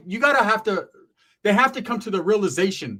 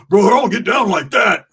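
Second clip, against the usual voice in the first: louder, higher put-on homeboy voice; Roach's voice; 4 semitones higher, 9 dB louder than usual. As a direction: play the angry friend on the phone. Roach voiced on phone call.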